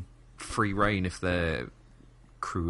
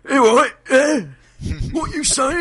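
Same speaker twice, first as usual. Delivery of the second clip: very high, loud London yelling; mock Londoner hollering across the street; hammed-up London accent, squawked loudly; 10 dB louder, slower than usual. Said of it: "You hear".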